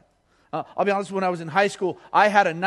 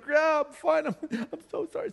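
imitating mom; 11 semitones higher than usual. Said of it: "She's like".

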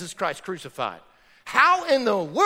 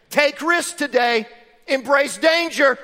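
slow, louder mock-bold mom; 5 semitones higher, 7 dB louder, slower than usual. Preacher mocking a mom urging risk, hammed-up. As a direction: playfully imitate a reckless mom.

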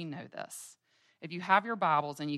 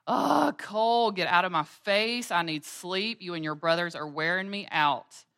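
higher, louder irritated wake-up snap; grumpy mom; 4 semitones higher, 8 dB louder than usual. Retold or imitated. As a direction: push the kid away, worn out and cross. Tired mom snaps at son.

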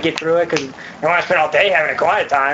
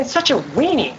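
ordinary delivery

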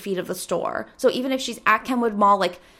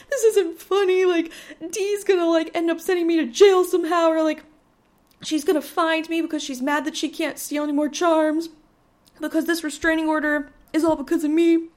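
panicked protest; 6 semitones higher than usual. Retold or imitated. Panicking to her laughing family.

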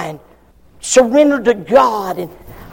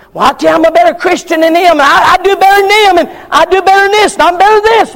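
high, loud, fast bragging; 8 semitones higher, 5 dB louder, faster than usual. Retold or imitated. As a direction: brag like a self-righteous believer. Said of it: "we have the tendency".

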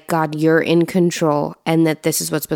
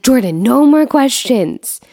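exasperated parent; 7 semitones higher, 4 dB louder than usual. Retold or imitated. Parent exasperated with questioning child.

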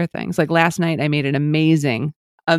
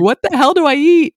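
high, loud, baffled cry; exasperated outburst; 12 semitones higher, 6 dB louder than usual. Retold or imitated. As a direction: throw up your hands in confusion. Retelling first confusion over diet.

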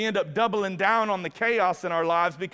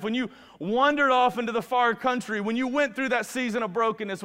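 preaching at full force; 5 semitones higher than usual. Acted. Preacher assuring wanderers God never left, full force. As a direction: drive it home, climbing to the peak. Sermon climax.

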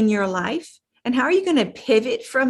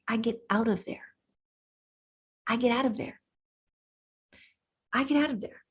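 softly repeated three times, fading away; quiet repeat; 8 dB quieter, much faster than usual. Real, not acted. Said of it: "I treat it like a rattlesnake".

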